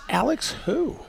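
puzzled, asking who's calling; confused. Retold or imitated. Answering the phone to a stranger, baffled and high.

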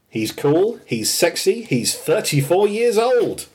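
mock excited build to "thirty-four years old"; hyped-up intro. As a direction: build it up like a hype announcer. Quoting the cool Homer line.

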